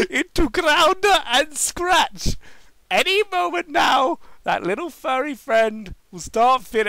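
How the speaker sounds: high, slow gleeful scheming; gloating villain. Acted. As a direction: savor the evil plan. Villain gloats over evil plan.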